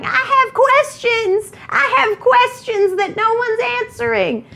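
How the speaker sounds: put-on high, slow quavering old woman; old lady voice. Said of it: "I'm gonna do an old lady voice".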